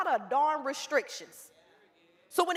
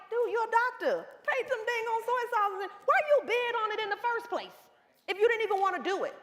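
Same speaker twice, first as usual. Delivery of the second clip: higher, mock-exasperated scolding; incredulous, raised-pitch scold; exasperated coach; 6 semitones higher than usual. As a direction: tell him off like a fed-up coach. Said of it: "And I'm like".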